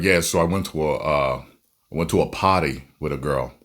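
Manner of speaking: low, quiet imitation of a Massachusetts accent. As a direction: drop the r like a Massachusetts coworker. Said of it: "his accent makes him say".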